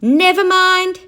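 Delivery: shouting. A narrator's reading. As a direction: call out over everyone. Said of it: "shouted Noisy Nick".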